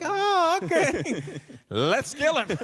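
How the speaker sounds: high-pitched, hammed-up offended writer; mock offended. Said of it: "and they get offended".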